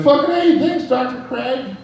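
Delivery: high, excited thanks to the chiropractor; grateful patient. Retold or imitated. Patient thanking chiropractor after an adjustment, high and excited. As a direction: gush like a back just got fixed. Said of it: "you're like".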